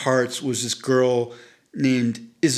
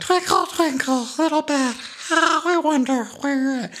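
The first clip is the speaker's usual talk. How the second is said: bubbly drunk impression; 12 semitones higher than usual. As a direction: croon it like a tipsy champagne drinker. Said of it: "bubbly quality to his voice".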